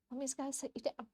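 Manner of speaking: normal talking voice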